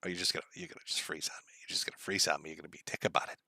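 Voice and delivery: whispered grumbling at a frozen screen; annoyed under-the-breath mutter. Real, not acted. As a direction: hiss it under your breath at the machine. Grumbling at frozen computer tab.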